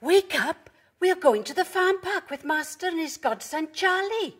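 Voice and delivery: high, excited dog character; Pepper. A narrator's reading. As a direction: rouse a sleepy friend in a high, lively voice. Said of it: "barked Pepper".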